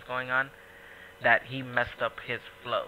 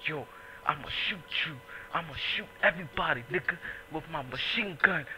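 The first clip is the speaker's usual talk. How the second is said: tough guy voice; 5 semitones higher than usual. Retold or imitated. Imitating a guy threatening on camera.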